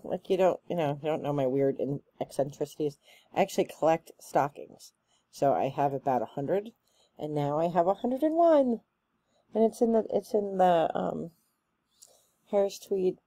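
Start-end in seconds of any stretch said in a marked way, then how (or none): none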